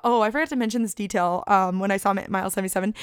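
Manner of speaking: ordinary delivery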